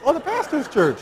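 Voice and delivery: a high, loud, amazed repeat; husband repeating it, amazed; surprised echo. Retold or imitated. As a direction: echo it back in disbelief.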